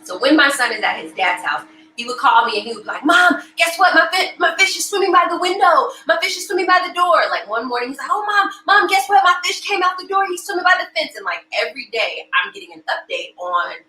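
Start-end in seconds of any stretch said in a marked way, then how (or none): 3.1-7.2 high-pitched imitation of an eager kid; excited little boy on the phone; excited child. Son's eager phone updates. 8.1-11.2 high-pitched imitation of an eager kid; excited little boy on the phone; excited child. Son's eager phone updates.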